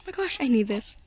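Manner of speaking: usual speaking voice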